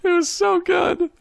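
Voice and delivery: high, slow, tearful with laughter; laughing squeal. Real, not acted. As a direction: squeal it through laughing tears.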